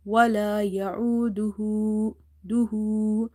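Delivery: يعوده with the last "hu" lengthened to "huu"; stretched ending. Shown as a demonstration. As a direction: hold the final vowel of duhu. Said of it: "read it by stretching up to one alif".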